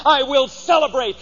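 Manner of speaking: ordinary talk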